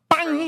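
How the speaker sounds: imitated gunshot bang. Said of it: "and you like".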